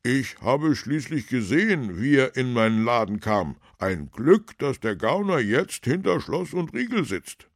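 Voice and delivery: high, loud, aggrieved shopkeeper; indignant baker. A narrator's reading. Baker argues about the thief, indignant and loud. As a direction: complain loudly as a wronged baker.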